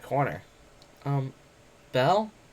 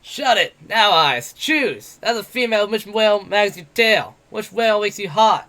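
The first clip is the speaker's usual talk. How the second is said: put-on loud, higher drunken bellow; drunk shouting brawler; 7 semitones higher, 7 dB louder, slower than usual. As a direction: roar it like a drunk bully demanding an answer. Bete voiced in the story.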